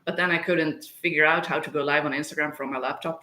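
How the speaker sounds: ordinary delivery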